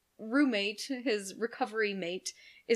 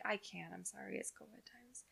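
fading whisper; 13 dB quieter than usual. Retold or imitated. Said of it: "I'm just like".

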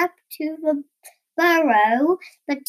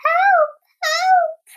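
high, loud cat yowl for help; meowed cry; 6 dB louder than usual. A narrator's reading. Scared Cat calls out for help, high meowing yelp. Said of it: "meowed Cat".

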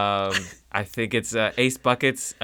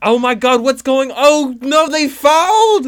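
much higher, louder, slow on the foul; shocked announcer; 10 semitones higher, 8 dB louder than usual. Acted. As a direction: cry out in disbelief at the foul. Commentator reacting to a foul.

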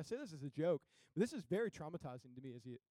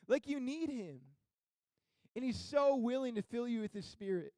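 high, emphatic pulpit delivery; impassioned preaching; 8 semitones higher, 6 dB louder than usual. Acted. Preacher telling church they need Jesus, impassioned. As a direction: preach it insistently, stretching the key words.

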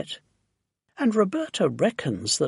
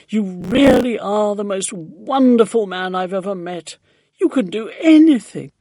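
loud, gushing exclamation, rising higher; crying out; 4 semitones higher, 6 dB louder than usual. A narrator's reading. Grateful neighbour hugs Mister Hoppy, crying out in delight. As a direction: gush with delighted admiration, voice raised. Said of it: "cried out".

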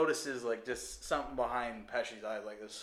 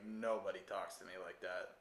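quiet menace; 7 semitones lower, 10 dB quieter than usual. Retold or imitated. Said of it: "Pesci's character where he's like".

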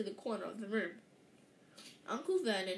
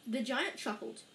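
usual speaking voice

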